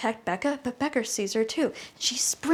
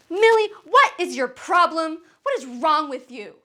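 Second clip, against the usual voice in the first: raised, exasperated outburst, easing at the end; angry confrontation; 5 semitones higher, 3 dB louder than usual. Acted. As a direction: confront a child at your wits' end. Confronts child after snapping.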